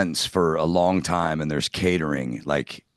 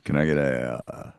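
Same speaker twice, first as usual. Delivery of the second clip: sexy voice; 4 semitones lower, 4 dB quieter than usual. Retold or imitated. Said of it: "do you say it in a really sexy voice".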